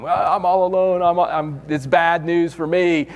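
high, whiny complaint; a hammed-up woe-is-me voice; mock self-pity. A doubting believer complaining to God, high and whiny.

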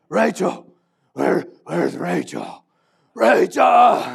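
gruff Batman growl building to a yelled "Rachel!". Acted. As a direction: play Jacob as Batman hunting for his bride. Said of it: "like, the best, um, uh, Batman voice".

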